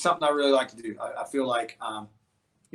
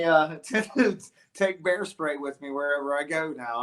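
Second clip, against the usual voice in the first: normal talking voice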